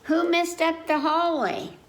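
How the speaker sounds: put-on grandma calling out to the child. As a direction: call out down the hall. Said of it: "Grandma called out".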